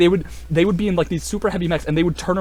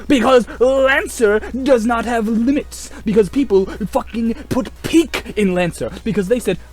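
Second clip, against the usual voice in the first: fervent proclamation; 8 semitones higher, slower than usual. Real, not acted. Declaring Lancer beats all mechs, high slow fervor. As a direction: preach it like gospel.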